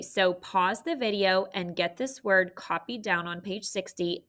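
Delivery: normal talking voice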